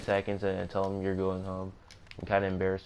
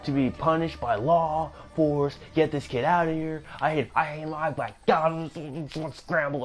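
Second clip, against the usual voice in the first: impersonating the raging man; 6 semitones higher than usual. Retold or imitated. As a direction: shout down the kid like he wronged you. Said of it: "that other guy's voice that I was doing the impersonation of".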